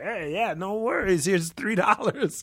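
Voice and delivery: high, casual dismissive tone; breezy entitled. Retold or imitated. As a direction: toss it off like a big shot. Imitating entitled tipper.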